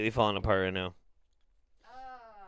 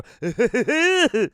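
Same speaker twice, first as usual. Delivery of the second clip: high squealing wheeze laugh; wheezing laugh; 11 semitones higher, 5 dB louder than usual. Real, not acted. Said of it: "that's how I wheeze".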